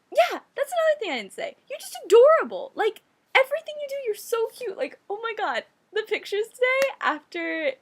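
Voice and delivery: high, loud, rapid gushing; excited gushing. Real, not acted. Gushing to her best friend on her birthday, high excited rush. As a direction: burst out with real adoring excitement.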